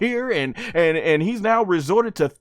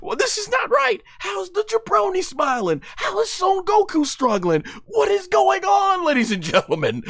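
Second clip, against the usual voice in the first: confused Goku; 9 semitones higher than usual. Retold or imitated. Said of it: "look at Go-, like".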